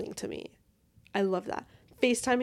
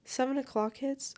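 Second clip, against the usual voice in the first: ordinary talk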